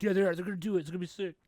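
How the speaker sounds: high, loud and eager; a hyped fan sure it will happen. Retold or imitated. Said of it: "the other half was like".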